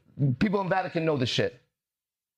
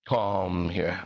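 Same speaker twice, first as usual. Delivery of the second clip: "Come here" said much lower and drawn out; 12 semitones lower than usual. Shown as a demonstration. Teaching the word, low drawn-out "Come here". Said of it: "You'd wanna come here".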